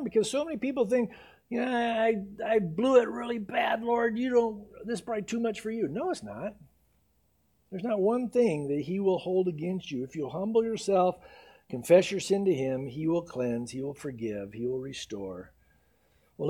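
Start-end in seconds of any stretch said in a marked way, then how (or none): 1.5-5.9 despairing sinner. Acted. People who think they blew it. 12.9-15.5 reassuring. Real, not acted. Assuring hearers of forgiveness.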